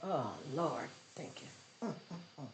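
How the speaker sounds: low, very soft, tearful thanks; overcome with emotion. Real, not acted. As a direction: barely get the thanks out, fading away. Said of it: "I can't do it".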